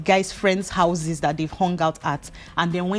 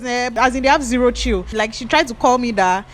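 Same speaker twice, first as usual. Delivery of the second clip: ordinary talk, nothing marked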